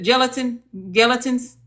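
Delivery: tentative "Gelatins", groping for the word; unsure mispronunciation. Real, not acted. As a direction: try "Gelatin" like the jelly, unsure. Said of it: "Did I say that right?".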